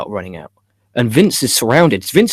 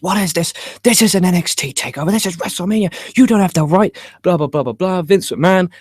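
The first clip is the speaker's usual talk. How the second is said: higher-pitched hammed-up announcer outrage; mock commentator scream; 6 semitones higher than usual. Michael Cole calling the NXT invasion, mock scream. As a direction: play the shocked commentator, half-yelling. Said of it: "Michael Cole screaming at the top of the lungs".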